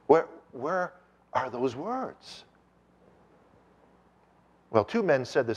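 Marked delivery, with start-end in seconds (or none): 0.1-2.4 slow, searching question; puzzled. Retold or imitated. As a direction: ask it slowly, as if hunting for something missing. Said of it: "looking at it in puzzlement".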